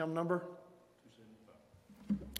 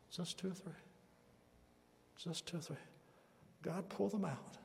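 soft, spaced-out phrases with long pauses; hushed preaching appeal; 13 dB quieter than usual. Real, not acted. Preacher asking the congregation to watch for fire from heaven, hushed appeal. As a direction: let each phrase sink softly into silence.

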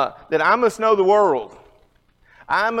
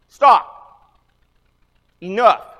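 stern command; 3 semitones higher, 9 dB louder, slower than usual. Retold or imitated. Teacher silencing a noisy class, loud and stern. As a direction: bark it like a strict teacher ending the noise. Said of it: "for me to say".

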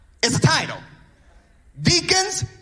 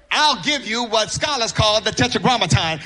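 normal talking voice

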